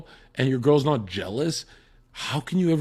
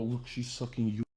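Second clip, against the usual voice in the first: soft mock aside; 10 dB quieter than usual. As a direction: murmur it to her. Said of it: "and you go".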